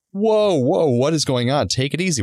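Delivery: alarmed whoa. Retold or imitated. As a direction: throw up your hands, startled.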